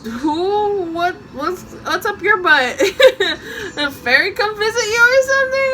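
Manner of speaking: giggling high teasing question; laughing at a giggly friend; laughing mock question. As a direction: tease her through laughter. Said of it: "I'm just like".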